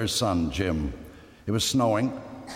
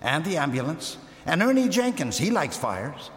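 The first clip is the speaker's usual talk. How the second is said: put-on eager boy; 5 semitones higher than usual. A narrator's reading. Boy eagerly adds to list.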